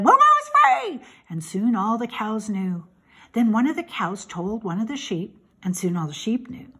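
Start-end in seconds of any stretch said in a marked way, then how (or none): 0.0-1.0 high shouted burst in narration; excited holler; shouted news. A narrator's reading. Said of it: "The goose shouted to the nearest cow".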